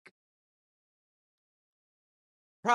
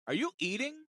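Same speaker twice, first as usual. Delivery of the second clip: incredulous; 9 semitones higher than usual. Retold or imitated. Incredulous question to agent.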